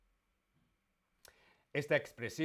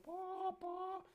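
high squeaky po po like a hen; cartoon chicken cluck; 9 dB quieter than usual. Shown as a demonstration.

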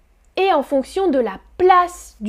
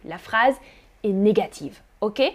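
ordinary talk, nothing marked

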